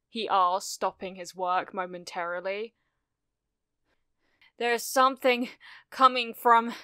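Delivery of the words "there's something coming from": out of breath, urgent report in short bursts; panting. A narrator's reading. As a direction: push out the words loudly between breaths. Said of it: "panted Jiro".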